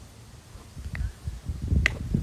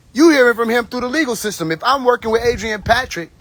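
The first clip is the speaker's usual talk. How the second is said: raised, heated rant; 6 semitones higher than usual. Real, not acted. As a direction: push the pitch up, exasperated. Angry rant, voice rising.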